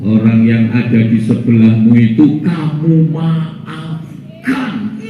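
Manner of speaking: ordinary talk, nothing marked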